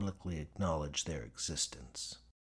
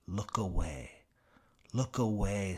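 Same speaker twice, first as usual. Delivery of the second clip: eerie whistling voice. Acted. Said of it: "says the whistling".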